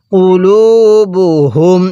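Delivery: কুলুবুহুম drawn out with long u vowels; measured model reading of কুলুবুহুম; slow tajweed recitation. Shown as a demonstration. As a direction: chant out কুলুবুহুম slowly, holding "luu". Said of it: "কিভাবে পড়ব?".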